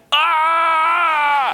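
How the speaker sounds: sudden high excited shriek; a thrilled fan's shriek at the president; excited scream. Retold or imitated. Screaming when the president points at him. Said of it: "I got excited".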